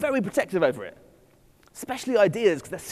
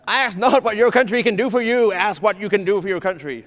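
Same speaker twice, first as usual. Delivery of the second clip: put-on higher, louder Boston accent, full oratorical JFK; JFK impression; 3 semitones higher, 7 dB louder than usual. Lecturer hamming up JFK's famous line in a Boston accent. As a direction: deliver it like JFK at his inauguration, in his Boston accent. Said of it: "can I do the accent?".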